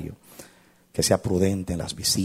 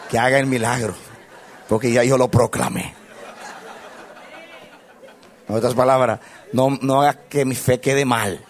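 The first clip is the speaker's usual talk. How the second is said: laughing plea; 6 semitones higher, 7 dB louder than usual. Real, not acted. Joking with God.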